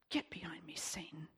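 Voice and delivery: soft, firm whisper of rebuke; hushed rebuke. Retold or imitated. Jesus rebuking Peter, hushed.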